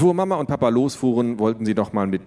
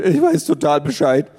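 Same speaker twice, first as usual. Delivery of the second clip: put-on loud, higher dopey teen boy voice; cocky boyfriend; 6 semitones higher, 6 dB louder than usual. Boyfriend reassuring the parents, loud and goofy. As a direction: play the clueless boyfriend. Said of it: "Justin sagte".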